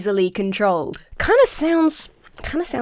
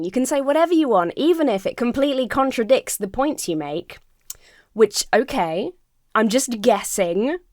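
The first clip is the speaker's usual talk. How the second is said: usual speaking voice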